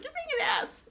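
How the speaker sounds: very high, squeaky imitation; high mock accusation. [name] insulting her castmate, mock accusing. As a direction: scold in an exaggerated high voice.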